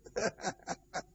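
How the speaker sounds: short laugh to himself; amused chuckle. Real, not acted. Preacher joking about what preachers must endure, amused chuckle. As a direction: chuckle at your own hardship. Laughs at own stoning tale.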